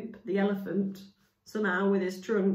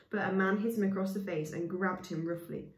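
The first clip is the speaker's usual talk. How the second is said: ordinary delivery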